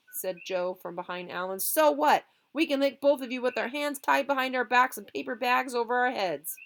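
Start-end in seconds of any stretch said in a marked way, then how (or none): 1.6-6.5 loud, high taunt. Acted. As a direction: dare them to fight. Boy taunting rivals.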